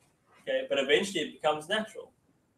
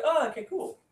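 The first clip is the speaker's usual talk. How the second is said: high, bright little "Oh, okay, cool"; imitating pleased friend; 14 semitones higher, 4 dB louder than usual. Friend who got a call, squeaking high with pleased surprise. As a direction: pop up into a higher squeak of recognition. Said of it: "I'm like".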